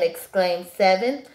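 ordinary delivery, nothing marked

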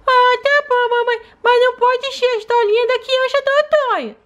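put-on high childlike voice of a small daughter; little girl voice. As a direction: play the sick little girl talking to mom. Doll answers mom.

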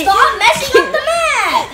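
high, slow, laughing protest; laughing complaint. Real, not acted. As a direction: whine it through laughter.

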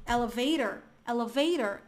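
"elevator" with tapped t and r-coloured ending; American pronunciation of "elevator"; American accent. Shown as a demonstration. Teacher's example of the middle T, American accent. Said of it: "é o americano".

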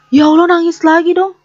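high, loud, fed-up outburst; exasperated cry. Real, not acted. To the crying sim baby, a high loud exasperated outburst. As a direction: throw up your hands and cry out at the screen.

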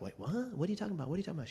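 high-pitched shocked disbelief; retold shock. Retelling his sister's diagnosis call, high shocked disbelief. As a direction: squeak it out in stunned confusion. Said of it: "And I was like".

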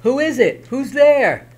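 high, loud startled cry; scared shouting into dark; frightened. Acted.

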